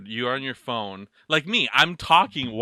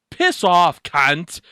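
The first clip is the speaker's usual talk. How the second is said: loud Australian swearing, pitch up; Australian accent; 6 semitones higher, 9 dB louder, much slower than usual. Shown as a demonstration. Australian accent shouted at a friend who asked for swears. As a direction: shout it like an Aussie bloke. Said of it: "That's an Australian one".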